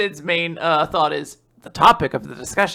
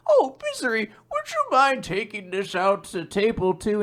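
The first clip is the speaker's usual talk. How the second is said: sweet boss voice; 4 semitones higher, 3 dB quieter than usual. Acted. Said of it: "her boss is like".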